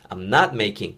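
"not" punched, the rest light; stress on not. Shown as a demonstration. Teacher showing learners where the stress falls, "not" punched. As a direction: hit the word "not" and glide through the rest. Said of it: "강세를 낫에만 넣으시면 되고".

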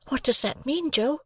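put-on high, boyish question; a small boy puzzled, asking Joe; young Pip. A narrator's reading. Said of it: "said I".

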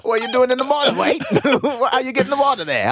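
loud, high mock Australian accent. Co-host questioning the rescued kangaroo, high mock Australian accent.

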